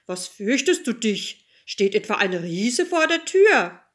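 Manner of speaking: a touch of a king's voice; lightly voiced king. A narrator's reading. King questions his frightened child, lightly voiced. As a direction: lightly play the father king. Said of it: "Der König sprach".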